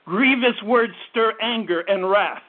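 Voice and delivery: ordinary talk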